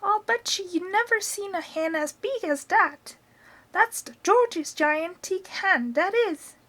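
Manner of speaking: put-on high, cackly old grandma calling down; high-pitched old woman showing off; Grandma's voice. A narrator's reading. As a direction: crow it from the rooftop as Grandma. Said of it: "Grandma shouted".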